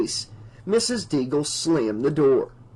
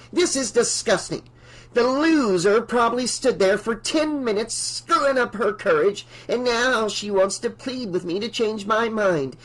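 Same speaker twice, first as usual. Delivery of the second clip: higher, scornful ranting voice; contemptuous old woman; 7 semitones higher than usual. Acted. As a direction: sneer at the little people. Mrs Deagle rants.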